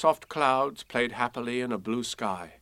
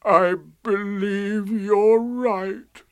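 slow, high, loud mournful donkey; long sad sigh; 8 semitones higher, 5 dB louder, much slower than usual. A narrator's reading. Eeyore admitting Pooh is right, sadly sighing. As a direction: heave it out dejectedly. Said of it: "with a long, sad sigh".

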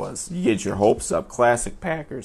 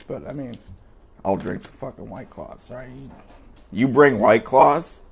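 usual speaking voice

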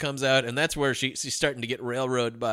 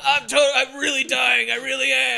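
hammed-up dying plea; 11 semitones higher, 5 dB louder than usual. Dying man pleading over the radio, high mock wail. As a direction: overact a man insisting he's dying.

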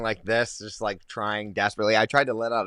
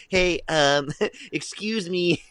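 high, hesitant, overly polite interruption; timid social worker; 6 semitones higher, much slower than usual. Acted. Social worker approaching the Joker mid-attack, meekly polite. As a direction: politely try to get a maniac's attention. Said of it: "a social worker's gonna show up".

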